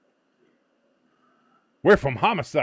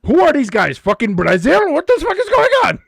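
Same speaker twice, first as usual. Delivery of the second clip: put-on loud, much higher Dutch-accented outrage; mock Dutch accent; 12 semitones higher, 10 dB louder than usual. Paraguay player outraged at the score, loud mock Dutch accent. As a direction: squeal it in a hammed-up Dutch accent. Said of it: "I gave them a Dutch accent".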